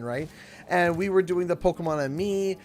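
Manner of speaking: ordinary talk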